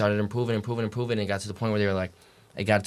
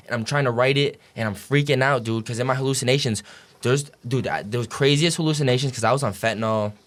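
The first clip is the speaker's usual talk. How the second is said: usual speaking voice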